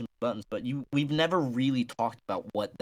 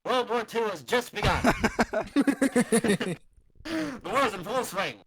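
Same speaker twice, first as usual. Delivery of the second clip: news announcer; 8 semitones higher than usual. Acted. Mock news bulletin bit.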